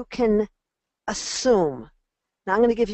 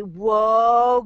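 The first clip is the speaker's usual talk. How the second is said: loud, drawn-out happy whoa; delighted whoa; 7 dB louder than usual. Shown as a demonstration. Coach cheering a child's small morning win, loud delighted whoa. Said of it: "you start saying, Whoa".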